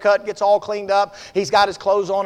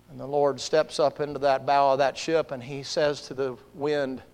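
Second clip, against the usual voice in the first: low, softened preacher's narration; hushed sermon storytelling; 5 semitones lower, 9 dB quieter than usual. Acted. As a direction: draw listeners into the scene quietly. Preacher hushes after the shout.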